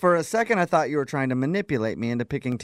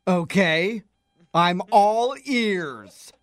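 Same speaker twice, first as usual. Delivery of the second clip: very slow, higher sarcastic drawl; 4 semitones higher, much slower than usual. Acted. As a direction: stretch it out mockingly. Sarcastic reply.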